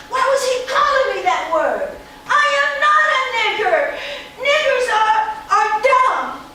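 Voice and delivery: put-on high, loud, eager little girl voice; a proud, excited child talking fast; eight-year-old girl.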